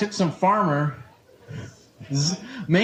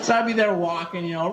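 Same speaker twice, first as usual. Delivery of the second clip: ordinary talk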